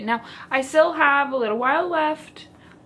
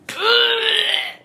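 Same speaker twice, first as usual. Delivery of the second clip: sharp theatrical gasp; mock shocked gasp; 11 semitones higher, 3 dB louder than usual. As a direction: gasp as if horrified. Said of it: "I'm hyperventilating".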